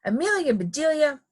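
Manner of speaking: high, scolding call of names; shouting. A narrator's reading. Missus Rogers to Amelia Bedelia and Alkaloo, high shout. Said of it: "shouted Miss".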